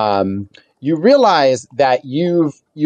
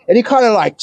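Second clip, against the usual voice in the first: normal talking voice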